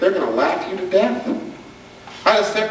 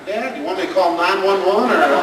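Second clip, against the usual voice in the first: laughing jab at dad; mock-helpful laughing teen; teasing kid; 3 semitones higher than usual. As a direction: tease it through laughter.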